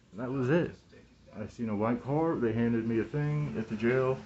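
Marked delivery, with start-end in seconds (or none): none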